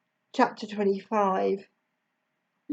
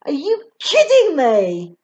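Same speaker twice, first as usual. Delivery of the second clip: loud, raised-pitch outburst; indignant narrated yell; 6 semitones higher, 8 dB louder than usual. A narrator's reading. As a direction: yell it in disbelief. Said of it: "yells Harry".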